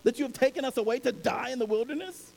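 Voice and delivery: high, frantic pleading of scared people; the Israelites panicking and blaming Moses; panicked complaint. Acted. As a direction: cry out in alarm to Moses. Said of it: "were terrified and cried out".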